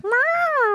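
very high imitated meow; cat meow. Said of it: "And he'll be like".

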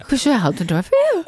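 lightly voiced jolly pizza chef; a hint of the pizza man. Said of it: "in my pizza man voice".

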